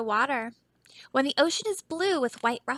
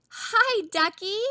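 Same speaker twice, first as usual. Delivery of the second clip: put-on high, sweet little boy hello; boy's greeting; 7 semitones higher than usual. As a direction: greet the duck like an eager child.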